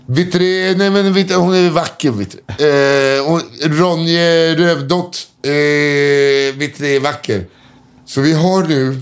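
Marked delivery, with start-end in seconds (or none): 0.1-7.5 put-on higher, slurring drunk insisting; drunk man. Drunk man insists the woman is beautiful, higher slur. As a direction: plead it like a tipsy old man. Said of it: "drunk man insists sister beautiful".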